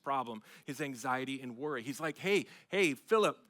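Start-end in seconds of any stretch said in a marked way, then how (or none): 2.2-3.4 higher, louder call to a friend; hey, get his attention. Retold or imitated. Luther calling out to his worried friend Philip, loud call.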